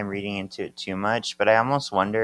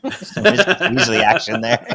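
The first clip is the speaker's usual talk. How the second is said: laughing; 7 dB louder, faster than usual. Real, not acted. Laughing after reading the curse.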